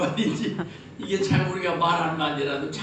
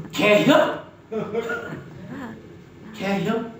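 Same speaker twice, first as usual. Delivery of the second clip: Chungcheong dialect; 4 semitones higher, much slower than usual. Shown as a demonstration. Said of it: "개고기 먹냐 이 말이야".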